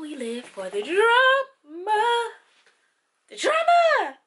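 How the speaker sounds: slow build to a high, loud cry; hammed-up drama. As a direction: stretch it out and climb theatrically. Hammed-up catchphrase about drama.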